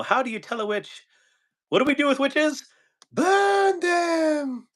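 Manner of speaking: high mock peasant voice building to a shrieked burn them; hammed-up angry mob, rising; witch scene villagers. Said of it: "I always do the, the witch".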